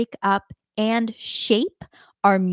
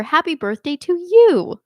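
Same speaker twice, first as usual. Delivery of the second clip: Happy birthday to you said higher and louder, falling on you; emphatic; 8 semitones higher, 6 dB louder than usual. Shown as a demonstration. Teacher shows a stronger emphasis, higher and louder. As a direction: belt out the you with a bright push. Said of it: "think about how you say the phrase".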